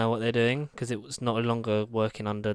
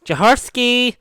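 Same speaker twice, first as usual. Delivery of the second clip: loud high shouted name; hammed-up shouted movie line; movie quote shout; 10 semitones higher, 12 dB louder, slower than usual. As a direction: yell the name like the film. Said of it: "What is that from?".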